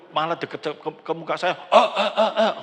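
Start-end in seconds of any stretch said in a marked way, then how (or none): 1.6-2.5 loud, higher mock coughs; repeated "eh" as pretend coughs; imitated coughing. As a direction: fake a child coughing right at someone. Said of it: "dia batuk".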